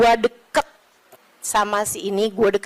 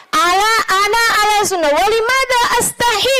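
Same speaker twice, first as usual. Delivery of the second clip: declaiming retort; 8 semitones higher than usual. Retold or imitated. Quoted Arabic retort, put-on voice.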